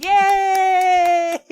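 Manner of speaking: high loud whooping "Yay!"; a real burst of glee; cheer. Real, not acted. Cheering the necromancy joke with a high loud whoop.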